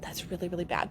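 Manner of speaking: whispered, a little naughty; guilty whisper. Real, not acted. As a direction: hush it like a guilty secret. Confessing naughty idea.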